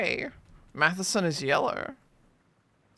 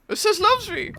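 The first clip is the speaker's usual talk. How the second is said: much higher and louder, delighted squeal; squeaky hopeful voice; 5 dB louder than usual. Pretend. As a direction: squeal it like a smitten girl. Petal game alternating voices.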